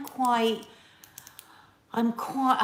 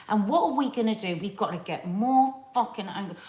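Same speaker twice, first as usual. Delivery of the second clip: normal talking voice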